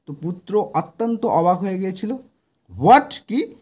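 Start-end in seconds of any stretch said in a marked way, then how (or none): 2.8-3.5 sudden high, loud startled "What?"; surprised cry. Acted. Son hearing about the grave, shocked. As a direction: blurt it out in shock. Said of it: "was extremely surprised".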